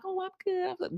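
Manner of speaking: high, bright mock "Oh, I'm good"; mimicking a fake-happy answer; fake cheerful. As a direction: put on a chirpy front.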